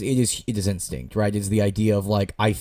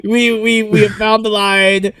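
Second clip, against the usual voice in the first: higher, slower, louder pleading; mock alarm; 9 semitones higher, 4 dB louder, slower than usual. Podcaster dramatically warning author George, higher and slower. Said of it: "pleading with author George".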